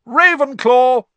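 big, high, drawn-out shout; shouted announcement. A narrator's reading. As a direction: proclaim it slowly to the whole hall. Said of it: "shouted the hat".

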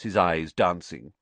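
ordinary delivery

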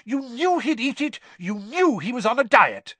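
high-pitched, heated accusation; angry roar. A narrator's reading. Father accusing his son of planning it, angrily. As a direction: drive the accusation home furiously. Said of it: "roared Mister Weasley".

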